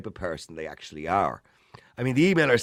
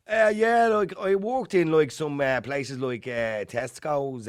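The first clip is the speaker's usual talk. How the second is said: higher-pitched, slow, halting thick Dublin accent playing a job candidate; thick inner city Dublin accent; 5 semitones higher, slower than usual. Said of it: "the interviewer might say to him".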